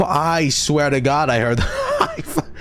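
high disbelieving laugh-talk. Real, not acted. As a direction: laugh through the words.

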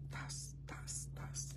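tas tas tas, whispered softly; whispered serving sounds. Retold or imitated. Telling his love how he refilled his plate, whispered serving noises. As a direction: mimic the scoop of rice under your breath. Imitating serving rice quickly.

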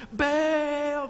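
high, loud, drawn-out call to Baal; crowd yelling to a god. Acted. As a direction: bellow the name upward like a desperate crowd. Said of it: "they yelled again".

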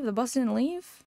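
ordinary delivery

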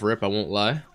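normal talking voice

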